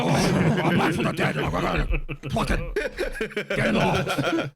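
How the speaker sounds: very slow, drawn-out presidential impression; hammed-up undead ex-president, words stretched out; Nixon. Playing a president declaring he is not dead, hammed-up impression. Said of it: "you went to Nixon".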